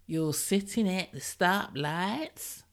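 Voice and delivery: slow, put-on American accent, "stoplights" drawn out American-style. Shown as a demonstration. Podcaster mimicking US listeners' word, slow American accent. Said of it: "I'm not even gonna do an accent, which I just did".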